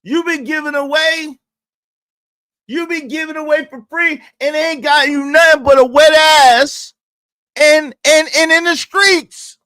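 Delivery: loud, high-pitched rant; shouting rant. Real, not acted. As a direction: yell it at her. Ranting at woman in clip.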